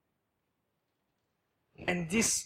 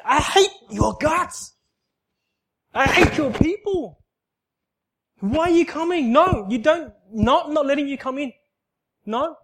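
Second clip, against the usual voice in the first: high, loud raging at God; angry refusal; 9 semitones higher, 5 dB louder than usual. Retold or imitated. Retelling how he raged at God, high and loud. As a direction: spit out the hatred, pushing him away.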